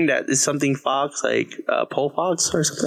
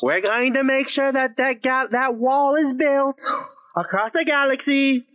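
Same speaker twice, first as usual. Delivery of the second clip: mock campaign politician; 10 semitones higher than usual. Campaigner promising a galactic wall, in mock stump-speech style. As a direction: proclaim it like a rally politician.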